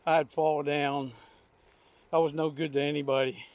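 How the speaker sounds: usual speaking voice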